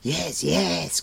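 high, eager yelp; eager creature cry. A narrator's reading. Gurgi eagerly answering Gwydion, high cry. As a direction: blurt it eagerly. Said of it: "Gurgi cried".

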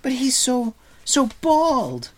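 high, loud, incredulous protest; stammering. A narrator's reading. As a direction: sputter in broken bursts of disbelief. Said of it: "Taran stammered".

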